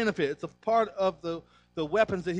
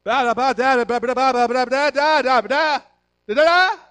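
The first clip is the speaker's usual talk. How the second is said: put-on high, loud insistent baby babble; 6 semitones higher, 9 dB louder than usual. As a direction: babble demandingly like a baby at the table. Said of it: "he'd be like".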